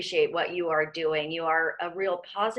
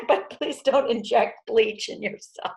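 laughing high through a slow joke; 3 semitones higher, slower than usual. Real, not acted. Host teasing guest about bleach, laughing through the words. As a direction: crack up while delivering the warning.

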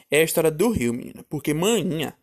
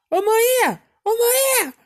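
calling out; 3 dB louder than usual. Retold or imitated. Sister at the door calling mother, imitated high call. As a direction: mimic a high-pitched holler for mom.

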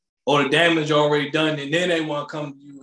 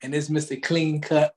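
ordinary delivery

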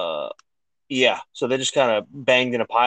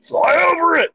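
high, loud yell imitating an order; mock shouted order; 5 dB louder than usual. Retelling an order to the helicopter pilot, high mock yell. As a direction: yell it high like an exasperated commander.

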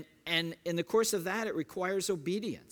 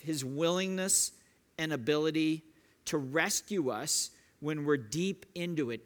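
ordinary talk, nothing marked

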